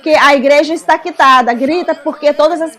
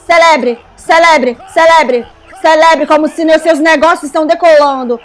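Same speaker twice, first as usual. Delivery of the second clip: preaching at full force. Acted. Preacher urges the congregation to shout for money, rousing. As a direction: whip the crowd up with each cry.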